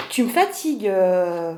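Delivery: normal talking voice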